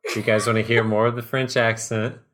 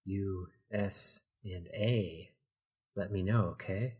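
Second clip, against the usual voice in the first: very soft, slow, secretive murmur; hushed undercover whisper; even quieter; 3 semitones lower, 12 dB quieter, slower than usual. A narrator's reading. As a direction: barely voice it, spacing the letters out. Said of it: "he got even quieter".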